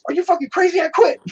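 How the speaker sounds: very high mock yell; outraged outburst. Reacting to the garnished paycheck, mock outraged yell. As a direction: erupt at the tiny paycheck.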